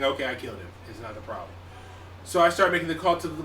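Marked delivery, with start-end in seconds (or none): 0.0-1.5 inner thought. Retold or imitated. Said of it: "I think".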